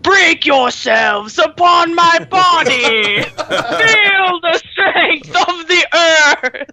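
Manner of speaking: booming stone giant, drawn out; big stone boss. Acted. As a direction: shout it slowly like a mighty stone boss. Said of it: "that big stone dude in Stonecore where he's like".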